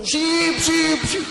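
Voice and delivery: high, loud cheer of approval; mock enthusiastic approval. Women comparing their medicines at the market, mock enthusiastic. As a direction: whoop it like gleeful market women.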